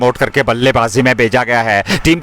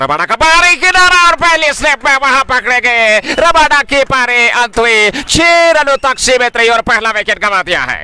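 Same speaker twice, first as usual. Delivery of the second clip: excited wicket call; 8 semitones higher than usual. Real, not acted. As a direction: call the catch with a burst.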